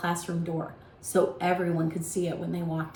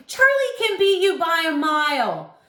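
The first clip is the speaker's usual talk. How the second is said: loud bragging; 9 semitones higher, 6 dB louder than usual. A narrator's reading. Tommy bragging to Darren.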